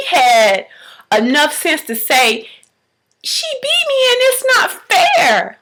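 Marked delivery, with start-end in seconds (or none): 3.2-5.6 put-on high-pitched child protesting; preschool daughter. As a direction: imitate a little girl's complaint. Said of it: "she's in pre-K".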